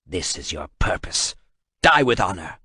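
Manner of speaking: a hard final sentence; cold verdict. Acted. Kratos condemning the translator, grim and low.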